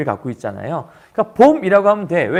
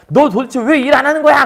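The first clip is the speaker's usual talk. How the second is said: louder, higher scolding demand; angry demand; 7 semitones higher, 6 dB louder than usual. Retold or imitated. Father-in-law storming up to scold the lazy son-in-law, angry. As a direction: confront him like an irate father-in-law.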